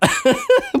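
very high sudden laugh; high burst of laughter. Real, not acted. Laughing at his friend's fresh water joke, a high burst.

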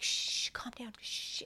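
soft unvoiced hush; shushing whisper. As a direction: whisper to calm him down.

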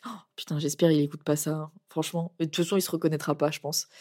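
soft, hushed confession; hushed aside. Real, not acted. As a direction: drop the voice, embarrassed. Aside hoping boyfriend won't hear.

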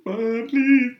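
high-pitched, loud, hammed-up wail; imitating a tearful, pleading meltdown; acted bawling. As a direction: gasp and sob the words out like a child crying to mom. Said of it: "I start bawling. I call my mom".

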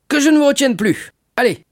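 very high, loud jovial urging; hearty dismissal. Acted. Shipowner releases the impatient young sailor, hearty dismissal. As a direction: shoo him off exuberantly. Shipowner shoos Dantès away.

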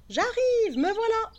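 high, eager little voice; excited. Acted. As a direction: chirp it out happily. The seed calls out.

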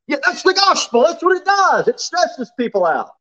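high-pitched preacher's outburst; emphatic exclaiming. Acted. Preacher answering the stressed listener about the gospel, high emphatic exclaiming. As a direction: cry it out with pitch way up.

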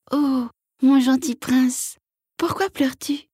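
high, slow tender frog voice; gentle little frog voice. Acted. Frog comforts weeping prince.

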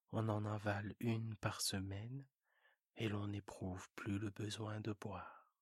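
low quiet narrator; low narration. A narrator's reading.

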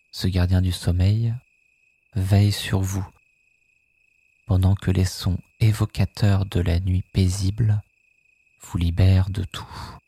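ordinary talk

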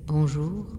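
bonjour grave et doux, plus bas; voix douce et grave. A narrator's reading. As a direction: une voix grave et douce qui résonne dans la pierre. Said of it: "résonna une voix douce et grave". (voix douce et grave, posée)

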